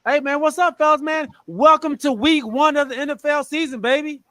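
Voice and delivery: loud, high hype greeting; hype intro. Real, not acted. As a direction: fire up the crowd to open the show. Podcast opening hype greeting.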